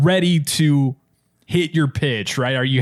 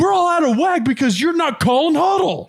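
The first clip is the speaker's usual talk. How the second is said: high, loud shouting at the center; pissed coach; 3 dB louder than usual. Retold or imitated. Football coach blaming the center, mock high shout. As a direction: yell blame like an angry coach. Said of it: "the coach always get pissed at the center".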